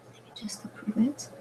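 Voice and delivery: whispered aside. Real, not acted. Muttered to herself at computer.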